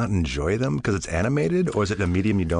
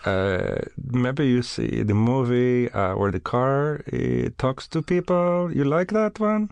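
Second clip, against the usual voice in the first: mock Bulgarian accent; 3 semitones higher than usual. Guest describing a movie to the host, mock Bulgarian accent. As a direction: grope for words in a put-on Bulgarian accent.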